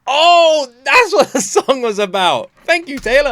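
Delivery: mock realisation. Fan finally getting the song, loud high mock revelation. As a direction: squeal it like the penny just dropped. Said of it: "And you're like".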